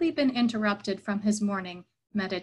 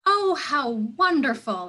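higher, louder delighted cry; exclamation; 5 semitones higher, 4 dB louder than usual. A narrator's reading. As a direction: gush with mock delight. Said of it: "exclaimed Patrul".

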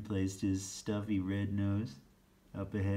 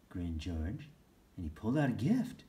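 ordinary delivery

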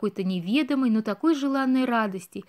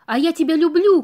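higher, louder declaration of love; shouted confession; 5 semitones higher, 6 dB louder than usual. Acted. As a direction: call it out to her. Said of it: "крикнул Бэмби".

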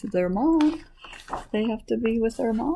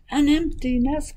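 high excited exclamation; chicken's cry; 8 semitones higher than usual. Acted. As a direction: exclaim as the storybook hen, high. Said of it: "cried the chicken".

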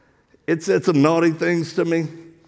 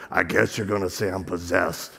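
demon voice; 11 semitones lower, 6 dB quieter than usual. Retold or imitated. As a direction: let a demon speak low through you. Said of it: "this voice comes out her".

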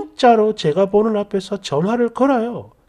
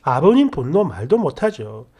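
ordinary talk, nothing marked

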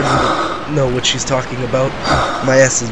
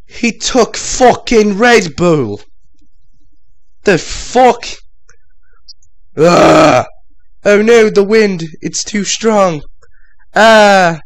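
high, loud frantic yelling; loud panicked cries; 8 semitones higher, 6 dB louder, slower than usual. Real, not acted. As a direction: shout against the wind. Panicked flying scene.

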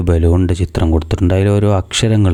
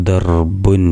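slow blending; much slower than usual. Shown as a demonstration. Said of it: "ചേർത്ത് വായിക്കുമ്പോൾ എങ്ങനെയാവും?".

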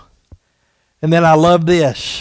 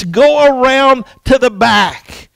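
loud, high and drawn out slowly; yelling again; 7 semitones higher, 6 dB louder than usual. Retold or imitated. Neighbor lady repeating her order to Johnny, loud and slow. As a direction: insist from the window, stretching the words. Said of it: "she yells at him again".

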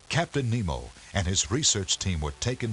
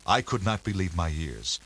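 ordinary delivery, nothing marked

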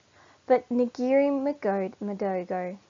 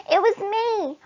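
much higher, louder squeaky confession; 12 semitones higher, 8 dB louder than usual. Acted. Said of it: "in a squeaky voice".

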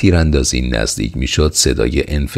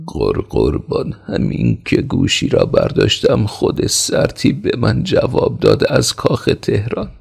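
broken, stammering speech; 9 semitones higher than usual. A narrator's reading. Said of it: "گفتارش نافهمیدنی و بریده بود".

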